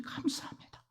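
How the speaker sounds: a breathless whisper of thanks to God. Retold or imitated. Believer thanking God for salvation, whispered. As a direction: barely voice the final thanks.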